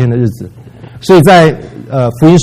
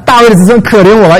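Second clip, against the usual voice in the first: higher, louder begging call; blind man crying out; 6 semitones higher, 4 dB louder than usual. Retold or imitated. Blind man keeps shouting though people hush him, high plea. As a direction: plead loudly upward. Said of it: "他就是在喊啊".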